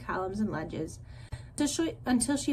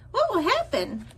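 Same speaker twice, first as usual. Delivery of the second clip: high gosling voice; 11 semitones higher, 5 dB louder than usual. A narrator's reading. Gosling voice in story.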